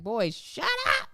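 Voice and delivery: mock snap. Mother imitating snapping at her crying son. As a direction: bark it like a fed-up parent. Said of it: "if I'd be like".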